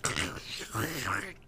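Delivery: low, soft crackly radio noise; imitated dispatch radio. Storyteller mimicking what the officer hears over dispatch, radio crackle. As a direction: mimic garbled dispatch static. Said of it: "over dispatch, he hears this".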